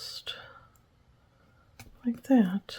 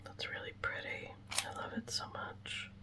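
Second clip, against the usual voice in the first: very soft, absent-minded whisper; 14 dB quieter than usual. Real, not acted. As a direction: drop to a real whisper, as if to yourself. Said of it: "I don't know why I'm whispering".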